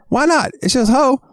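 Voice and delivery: high cartoon kid protest; mock defiant high voice; kid talking back. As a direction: argue back in an imitated child's voice.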